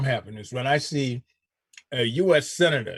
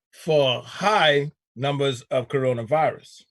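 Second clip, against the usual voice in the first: ordinary talk, nothing marked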